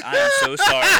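high, loud mock-sorry through a laugh; laughing apology. Telling the Texarkana woman he's sorry, laughing and high. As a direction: apologise while cracking up. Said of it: "And I'm like".

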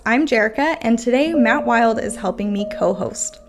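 ordinary delivery

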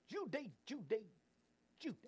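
lightly voiced Cary Grant, a hint of Hepburn; a light take on Cary Grant saying Judy; Cary Grant impression. Host doing Cary Grant for viewers, lightly voiced impression. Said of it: "a cross between Cary Grant".

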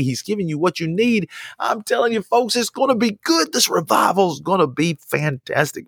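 high, rising preacher's cry of promise; excited preaching. Acted. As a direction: lift it up with joy. Preaching at full force.

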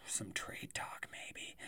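sighing hushed whisper. Pretend. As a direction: tease it in a whisper. Teasing upcoming trade talk.

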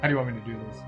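ordinary talk

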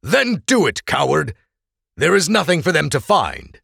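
high, loud defiance; defiant shout. Acted. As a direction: hurl it back at him. Defiant retort to blackmailer.

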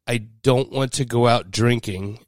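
slow, patient correction; slow explanation. Retold or imitated. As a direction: spell it out slowly. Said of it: "And she said".

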